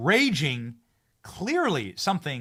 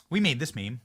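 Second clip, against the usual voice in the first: ordinary delivery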